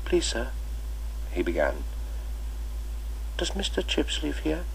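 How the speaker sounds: put-on shy new boy asking at the door; timid small boy. Boy's timid voice in story.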